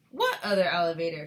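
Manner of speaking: loud, alarmed question, mildly screamed. A narrator's reading. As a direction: demand it loudly, short of screaming. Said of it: "screamed Missus Teavee".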